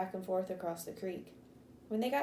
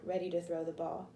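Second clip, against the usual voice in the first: plain reading voice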